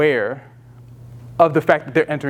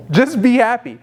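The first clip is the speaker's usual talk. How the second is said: mock stern father, higher and louder; father ordering; 6 semitones higher, 3 dB louder than usual. Father telling his upset kid to cheer up, mock stern. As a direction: bark it like a dad demanding a smile. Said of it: "where the father's looking at the kid".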